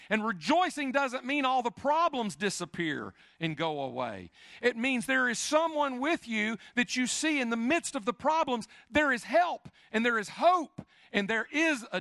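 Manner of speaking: high, loud preacher's climb to a peak; preaching at full force. Acted. Pastor to congregation on hope in problems, full-force preaching. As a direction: lift the congregation with hope, ringing out.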